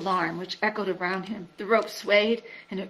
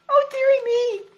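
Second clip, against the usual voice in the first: high, slow, quavering little whimper; a tiny creature close to tears; whimpering Piglet; 9 dB louder than usual. A narrator's reading. Piglet whimpering at the thought of going down the well.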